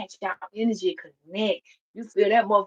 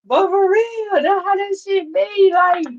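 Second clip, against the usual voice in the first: excited; 11 semitones higher, 5 dB louder than usual. Real, not acted. Laughing rant after joke.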